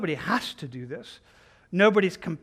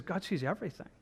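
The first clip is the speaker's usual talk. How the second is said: much softer, solemn statement; quiet, grave pronouncement; hushed revelation; 10 dB quieter, faster than usual. Acted. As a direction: drop the volume right down for weight. Solemn quote after unseen claim.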